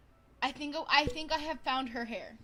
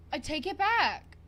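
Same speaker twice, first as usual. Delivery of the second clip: loud, higher excited retraction; 5 semitones higher, 9 dB louder than usual. Real, not acted. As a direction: exclaim it with delight. Excited reaction to sim.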